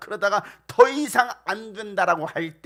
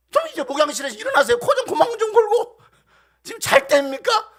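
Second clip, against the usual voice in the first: loud, high-pitched indignant tirade; angry confrontation; 9 semitones higher, 11 dB louder than usual. Acted. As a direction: shout the sleeper awake, demanding help. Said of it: "막 따지듯이 예수님께 화를". (따지듯 높고 크게 화내며)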